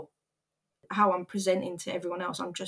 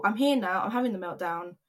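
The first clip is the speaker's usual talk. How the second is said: ordinary delivery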